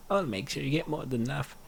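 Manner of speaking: ordinary talk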